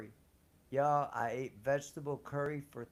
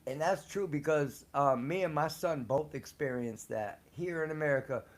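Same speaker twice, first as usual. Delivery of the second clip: ordinary talk, nothing marked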